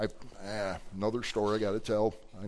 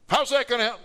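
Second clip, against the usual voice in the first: high, loud mock protest; imitated incredulous outburst; exasperated woman; 13 semitones higher, 7 dB louder than usual. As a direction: butt in indignantly as the upset woman. Acting the upset woman's protest.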